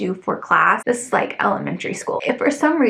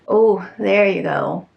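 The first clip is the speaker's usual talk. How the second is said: ordinary delivery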